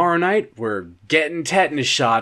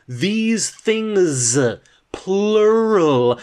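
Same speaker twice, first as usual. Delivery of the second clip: THESE THINGS drawn out, then PLURAL higher; pointed indignation; 4 semitones higher, much slower than usual. Acted. Indignantly picking at the wording.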